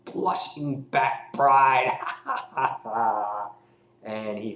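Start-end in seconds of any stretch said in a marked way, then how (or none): 0.0-2.0 high, theatrical villain proposal; hammed-up villain voice, slowing grandly at the end; Joker. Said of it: "in the end, he just goes". 2.0-3.5 imitated villain laugh; hammed-up evil cackle trailing the proposal; Joker cackle. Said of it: "he says".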